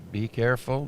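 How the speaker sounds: normal talking voice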